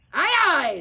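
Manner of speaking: loud, high hearty reply; pirate aye aye. Acted. The parrot answering Pete, loud and high. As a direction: answer like a keen sailor. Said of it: "said the pirate".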